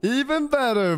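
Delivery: high, delighted exclaim; excited. Real, not acted. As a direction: gush with delight.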